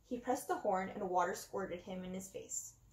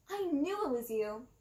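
lightly voiced clown villain, accusing; a hint of a gloating clown; 2 semitones higher than usual. A narrator's reading.

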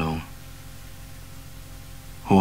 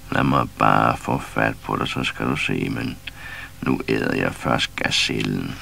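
much lower, slow growling threat; low lion growl voice; 8 semitones lower than usual. A narrator's reading. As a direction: rumble it like a big cat.

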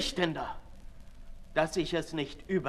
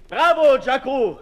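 sharp mocking cheer, much louder; loud bravo; 9 semitones higher, 12 dB louder than usual. Sade mockingly cheers Jacques, loud sarcastic bravo. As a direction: hurl a sarcastic bravo at him.

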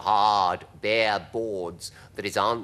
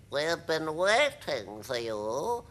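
slow, higher drawn-out creature voice; slow and higher, in character; second creature voice; 7 semitones higher, much slower than usual. A narrator's reading. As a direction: draw it out as another strange creature. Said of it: "another one said".

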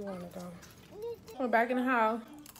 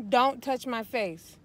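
slow, loud, stern scolding; angry dressing down; 3 semitones higher, 10 dB louder, much slower than usual. Real, not acted. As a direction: hammer each word home. Mother repeats scolding.

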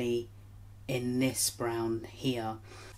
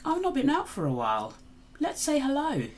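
cheery sun voice; 14 semitones higher, 5 dB louder than usual. Acted. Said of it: "the sun was like".

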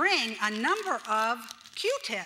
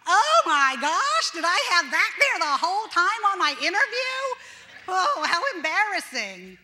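mock embarrassed wail; 5 semitones higher, 8 dB louder than usual. Someone home after an interview, loudly dismayed. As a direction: wail over your own embarrassment. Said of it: "you come home and you go".

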